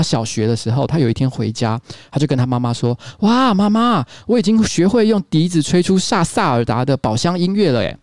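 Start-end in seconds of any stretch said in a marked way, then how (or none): 3.2-8.0 put-on high, bright child's squeal of pride; a little boy bursting to show mom; excited kid. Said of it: "他很兴奋的跑去跟他妈妈讲".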